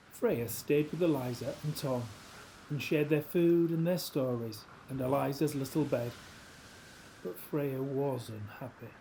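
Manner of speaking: ordinary delivery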